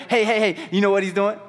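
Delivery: high, confident taunt; cocky mocking. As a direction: taunt them with swagger. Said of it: "How men do when they get confident".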